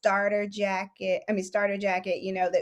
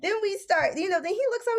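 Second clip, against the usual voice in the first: ordinary talk, nothing marked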